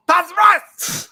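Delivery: high, loud, stretched-out catchphrase; mock shout. Friend imitating Kyle at the table, mock shouting. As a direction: holler it slowly in a put-on voice.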